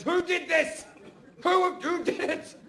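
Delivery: high, drawn-out outraged demand; furious mum. Retold or imitated. Mum comes home to a puddle of urine, furious. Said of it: "she's fucking furious".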